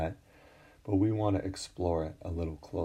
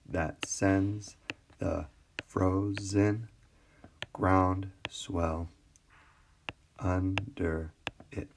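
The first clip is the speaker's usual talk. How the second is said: slow, beat by beat, "that SENDS the FRO-zen GROUND swell UN-der it"; deliberate metrical reading with pauses between feet; scanning iambic meter; much slower than usual. Shown as a demonstration. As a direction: pull "frozen" and "under" apart into syllables. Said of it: "we do see ten syllables".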